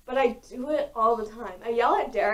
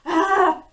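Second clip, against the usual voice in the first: short frustrated "ah" shout, higher and louder; frustrated yell; 7 semitones higher, 8 dB louder than usual. Retold or imitated. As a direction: blurt out your frustration with the dog. Frustrated with distracted dog.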